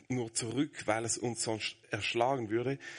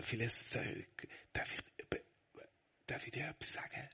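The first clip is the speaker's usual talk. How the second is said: fast whisper of a secret; 8 dB quieter, faster than usual. Retold or imitated. Father asking his son a second time, whispered. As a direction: breathe the words to him. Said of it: "Ich frage ihn zweites Mal".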